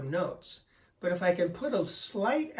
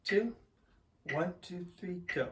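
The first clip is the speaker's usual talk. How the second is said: One, two counted off to start. Shown as a demonstration. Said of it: "let's try number two".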